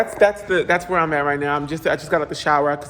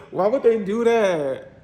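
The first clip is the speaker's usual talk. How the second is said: slowed, higher "Why would they do that?" through a laugh; laughing disbelief; 4 semitones higher, slower than usual. Real, not acted. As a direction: laugh at the ugly counters.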